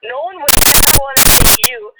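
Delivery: angrily quoting what he said to her; mock outburst. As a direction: throw his words back loudly. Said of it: "you're coming to this conclusion".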